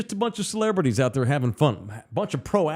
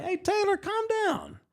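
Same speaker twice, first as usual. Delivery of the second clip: very high imitation of an Arkansas twang; Brooks Robinson; 4 dB quieter than usual. Manager calming his player as he passes, in an Arkansas twang. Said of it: "In that Arkansas twang".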